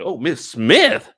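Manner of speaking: high, loud, slow disbelief; incredulous. Acted. Commentator questions him calling her Miss Smith, incredulous. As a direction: stretch Smith into a shocked question. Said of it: "I'm like".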